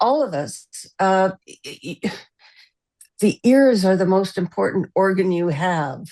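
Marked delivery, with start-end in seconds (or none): none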